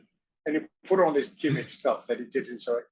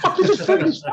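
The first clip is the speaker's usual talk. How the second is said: laughing; 9 dB louder than usual. Real, not acted. Laughing at his own story.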